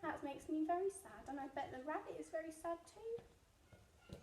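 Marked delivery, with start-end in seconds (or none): none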